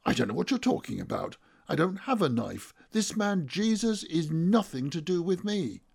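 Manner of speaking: rushed start climbing to high insistence; vehement denial. A narrator's reading. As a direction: disown him desperately. Frightened denial of the accusation.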